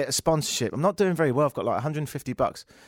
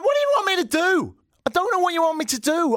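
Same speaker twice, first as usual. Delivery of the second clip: exasperated; 3 dB louder than usual. Retold or imitated. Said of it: "And I'm like".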